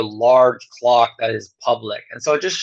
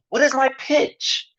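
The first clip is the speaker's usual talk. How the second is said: high rising question; 12 semitones higher than usual. Shown as a demonstration. Lecturer demonstrating pitch to students, much higher voice. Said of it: "our pitch goes up at the end".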